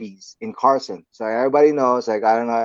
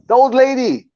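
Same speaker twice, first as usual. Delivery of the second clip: high, loud blurted reveal; loud exclamation; 13 semitones higher, 9 dB louder than usual. Retold or imitated. Friend revealing who is yelling, loud exclamation. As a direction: imitate an exasperated friend, pitched way up. Retold answer naming who yells.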